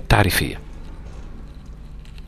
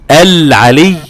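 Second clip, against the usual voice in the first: loud, higher chanted العلي; recited demo; 5 semitones higher, 10 dB louder, much slower than usual. Shown as a demonstration. Teacher recites an example word for the student to hear. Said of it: "في السماع يختلف".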